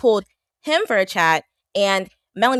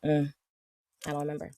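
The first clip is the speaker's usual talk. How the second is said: normal talking voice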